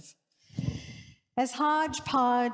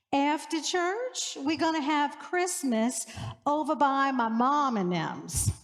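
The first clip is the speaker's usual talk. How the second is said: slow New Orleans Yat drawl, stretched and lilting; 2 semitones higher than usual. Shown as a demonstration. Priest showing her congregation the New Orleans Yat drawl. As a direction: put on a homey New Orleans Yat accent. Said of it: "lending the whole thing a sort of drawl, like this".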